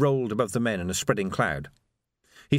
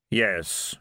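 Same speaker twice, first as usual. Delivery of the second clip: grim reaper voice; 3 semitones lower than usual. A narrator's reading. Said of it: "said Death".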